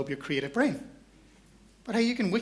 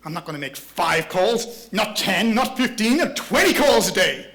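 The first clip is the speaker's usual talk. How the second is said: loud, gung-ho general rallying himself; General Frontal Lobe; 4 dB louder than usual. Acted. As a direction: fire yourself up like a general, building to the number. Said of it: "let's call this character of your committee General Frontal Lobe".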